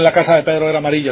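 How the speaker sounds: "la casa de Pedro era amarilla" rushed as one word, fast, no gaps; run together. Shown as a demonstration. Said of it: "todo junto".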